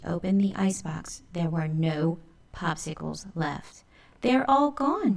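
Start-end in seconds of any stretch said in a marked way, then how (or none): 4.2-5.2 higher, louder cry of dismay. Acted. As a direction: wail at the empty icebox. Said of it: "cried Alley Cat".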